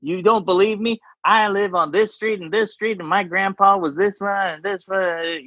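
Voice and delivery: hammed-up hometown pride voice; proud Texan. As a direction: brag about roots like a proud local. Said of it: "It's like".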